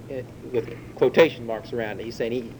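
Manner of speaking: usual speaking voice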